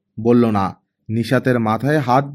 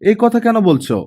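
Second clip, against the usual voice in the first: higher, mildly surprised question; a touch of hurt surprise, voiced higher; 8 semitones higher than usual. A narrator's reading. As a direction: ask it as if caught off guard, lightly. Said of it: "অবাক হয়ে বলল".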